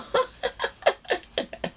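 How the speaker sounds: real laugh, high and airy; high breathy laugh. Real, not acted. Laughs at her own retort.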